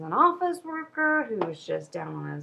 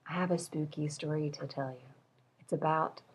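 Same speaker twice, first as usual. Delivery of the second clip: spooky hush; 4 semitones lower, 8 dB quieter than usual. Acted. Teasing a spooky story.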